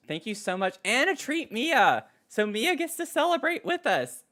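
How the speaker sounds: high, loud, thrilled thank-you; bursting with joy at the donation; excited thanks. Real, not acted.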